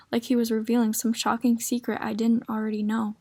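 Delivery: plain reading voice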